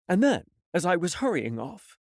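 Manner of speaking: plain reading voice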